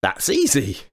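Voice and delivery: put-on high boyish voice; boy's voice. A narrator's reading. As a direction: sound cheerful and clever as a boy. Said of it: "said Jack".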